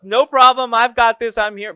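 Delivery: hammed-up cheerful webinar host covering for a glitch; cheery take-over. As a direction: jump in loud and upbeat to rescue the show. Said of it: "And I said".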